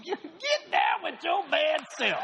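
playful shout of approval; hammed-up cheer. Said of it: "I said".

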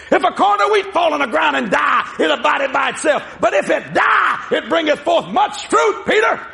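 shouted King James verse; booming pronouncement. Acted. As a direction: thunder the scripture out like a prophet. Jesus's pronouncement acted by preacher.

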